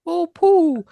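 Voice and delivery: failed Pooh voice. Retold or imitated. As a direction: reach up for the Pooh voice and miss it. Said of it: "I was trying to do Pooh".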